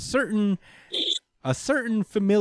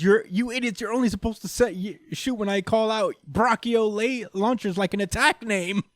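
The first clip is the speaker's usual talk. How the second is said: high, exasperated mock scolding; mock yelling; 7 semitones higher than usual. Host imitating Queen telling off his own lasers, mock yell. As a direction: retell a tantrum in a raised pitch. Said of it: "turns around and he yells".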